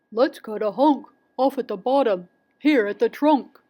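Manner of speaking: hint of a storybook papa bear; lightly voiced bear. A narrator's reading. Papa bear suggests cutting the trunk, lightly voiced. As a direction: speak with a touch of bear character. Barnaby Bear's line in story.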